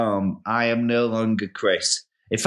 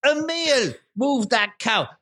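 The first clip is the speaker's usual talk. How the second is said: put-on higher, brisk farmer in an Irish-sounding accent; busy Irish-sounding farmer; 7 semitones higher than usual. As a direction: shoo the visitor off while barking farm chores in an Irish-sounding accent. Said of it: "what part of Ireland are you from".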